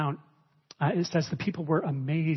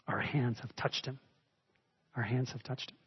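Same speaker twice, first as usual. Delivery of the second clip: softer, lower, lingering on hands; tender quiet quote; 6 semitones lower, 8 dB quieter than usual. Shown as a demonstration. Preacher to congregation on the line he loves, softly tender. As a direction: hush it like something precious.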